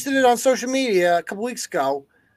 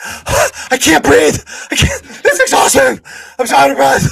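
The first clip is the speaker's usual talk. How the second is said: mocking a man overwhelmed by a photo, panting and wailing; mock breathless drama queen; 5 semitones higher, 7 dB louder than usual. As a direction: ham up a drama queen who can't catch his breath. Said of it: "He's like".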